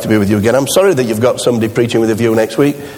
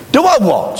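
startled high "Do I what?"; a wife caught off guard, pitched up; surprised wife; 11 semitones higher than usual. Retold or imitated. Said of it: "she says".

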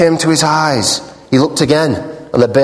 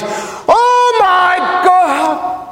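high, slow, hammed-up cry of alarm; mock cry; much slower than usual. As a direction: cry it out in mock terror, drawn out. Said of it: "the atheist cried".

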